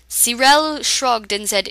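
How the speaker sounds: plain reading voice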